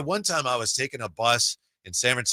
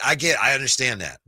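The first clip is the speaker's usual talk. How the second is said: ordinary talk, nothing marked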